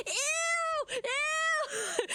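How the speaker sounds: laughing, high retold scream of disgust; mock scream. Retelling a friend's reaction on stream, laughing mock scream. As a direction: shriek it like the grossed-out friend. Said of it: "immediately starts screaming".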